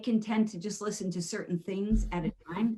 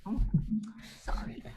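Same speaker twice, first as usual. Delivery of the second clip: ordinary talk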